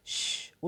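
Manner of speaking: voiceless hushing "shh"; shushing. A narrator's reading. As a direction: shush a companion softly. Hushing a companion while hiding.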